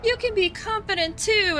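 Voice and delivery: high, chirpy mock influencer pep talk. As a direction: chirp it like a fake upbeat influencer. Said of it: "an influencer and be like".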